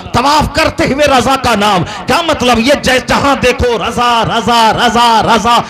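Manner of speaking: high, loud rhythmic sermon climax; full-force preaching. Real, not acted. Preacher praising Raza to a roused crowd, at full force. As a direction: build a repeated refrain to a shouted peak.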